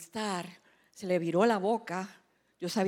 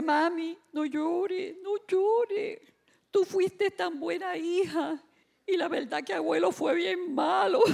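high-pitched imitation of a consoling daughter; imitated daughter; 8 semitones higher, slower than usual. Daughter comforting her weeping mother, in high imitated voice. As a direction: voice the daughter, much higher than yourself. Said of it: "Y cuando mi hija".

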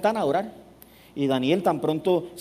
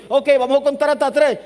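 instructor call; 9 semitones higher, 7 dB louder than usual. Retold or imitated. Instructor counting before the jump.

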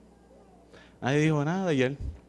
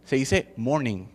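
slow, lower, corrective morning; slow correction; 8 semitones lower, 7 dB quieter, slower than usual. Retold or imitated. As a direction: spell out that it's just morning. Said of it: "Es que no se dice good morning".

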